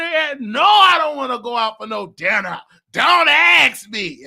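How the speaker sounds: loud, rude brush-off, last words dragged out slowly; rude shouted refusal. Retold or imitated. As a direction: bark him away, ending slow and heavy. Rude refusal, slams door.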